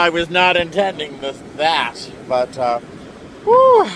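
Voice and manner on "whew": whew. Real, not acted. After wave soaks feet.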